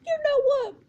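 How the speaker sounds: high, loud burst; loud, high-voiced interruption; sudden interjection. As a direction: cut in forcefully, pitched right up. Acted interjection before defending Matt.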